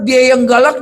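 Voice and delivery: ordinary delivery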